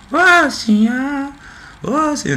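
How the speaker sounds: slow high falsetto chorus; man doing a woman's voice. Shown as a demonstration. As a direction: sing the women's chorus up high, slowly. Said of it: "eu tô fazendo uma voz de mulher".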